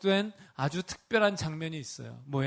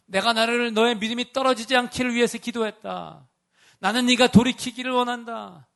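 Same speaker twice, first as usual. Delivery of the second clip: earnest voice of the Lord; 6 semitones higher, 6 dB louder than usual. Pretend. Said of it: "그 주님의 말씀".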